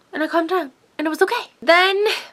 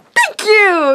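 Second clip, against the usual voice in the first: "Thank you" said brighter and higher, echoing him; a higher, cheerful "Thank you" you can't help saying back; catching his cheer, higher; 8 semitones higher, 6 dB louder than usual. Retold or imitated. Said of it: "you can't help just be like".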